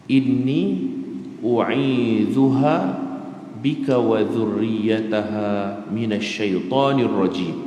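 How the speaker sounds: slow melodic chanting of the verse; drawn-out Arabic recitation; Quranic recitation. Acted. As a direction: recite it slowly like prayer.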